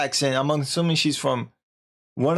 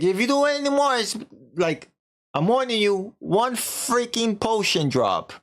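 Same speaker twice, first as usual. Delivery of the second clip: mock warning; 7 semitones higher than usual. I'm warning you.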